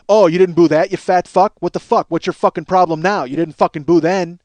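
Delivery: loud, aggressive mock challenge; angry taunt. Karaoke host berating the man who booed, angry taunt. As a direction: berate him at full volume, imitating the host.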